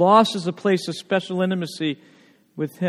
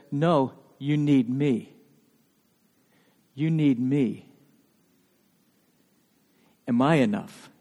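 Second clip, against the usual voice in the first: tender reply; 5 semitones lower, 6 dB quieter, slower than usual. Retold or imitated. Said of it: "You know what Jesus said?".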